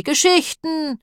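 high, drawn-out sheep's cry; bleating sheep. A narrator's reading. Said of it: "blökten sie".

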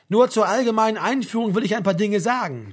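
a woman opening her speech loudly at the lectern; speaker at a podium. Acted. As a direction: address the crowded assembly hall.

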